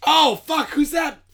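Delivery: high, loud shocked outburst; real startled yelp. Real, not acted. Friend startled by scary picture, high loud alarm. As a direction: jump and blurt it out, startled. Startled by sudden scare scream.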